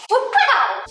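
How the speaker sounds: high, loud delighted wow; excited wow. Real, not acted. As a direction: gasp of delight at the result. Cookies out of the oven.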